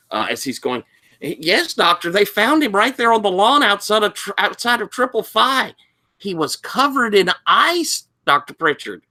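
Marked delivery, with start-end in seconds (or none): 1.2-9.0 high-pitched eager student; teenaged boy voice. Acted. Student reporting a strange find to the doctor, teen voice. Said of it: "teenaged boy voice".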